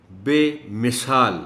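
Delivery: بے مثال slow, i under م made clear; careful pronunciation. Shown as a demonstration. Teacher models بے مثال slowly for children. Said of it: "میم کے نیچے کی زیر کو".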